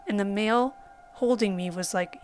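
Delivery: ordinary talk, nothing marked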